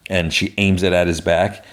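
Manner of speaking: ordinary talk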